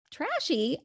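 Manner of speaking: "Trashy?" jumps up high in disbelief; incredulous echo. Retold or imitated. Midwesterner echoing his insult in disbelief. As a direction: throw "Trashy?" back at him, stunned and offended. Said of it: "And I was like".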